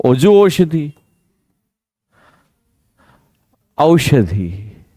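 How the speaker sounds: औषधि said very slowly, au held long; औषधि, draw out the aau; drawn-out au. Shown as a demonstration. Teacher slowly stretches औषधि for the class. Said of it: "तो औषधि होता है".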